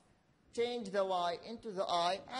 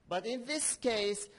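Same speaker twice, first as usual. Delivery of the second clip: normal talking voice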